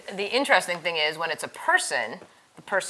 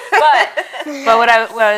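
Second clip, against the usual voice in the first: laughing; 6 semitones higher, 14 dB louder than usual. Real, not acted. Laughing at cartoon idea.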